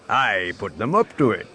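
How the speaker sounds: resonant unseen crime boss; disembodied baritone voice. A narrator's reading. Unseen boss offering guests drinks, resonant baritone. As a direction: suggest it as a resonant, hidden host. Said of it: "source of the resonant voice".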